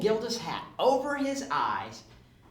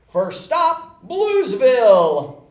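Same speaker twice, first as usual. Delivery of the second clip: louder, slow triumphant call; Santa announcing; 5 semitones higher, 11 dB louder, slower than usual. Acted. Santa calling out the first stop loudly. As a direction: Santa proclaiming to the sleigh.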